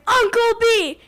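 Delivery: calling out to the uncle in the woods, loud; yelling a name. Retold or imitated. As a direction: shout for him across the camp. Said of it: "we yelled out my uncle's name".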